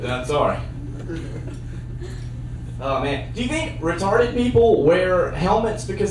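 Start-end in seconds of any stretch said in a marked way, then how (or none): none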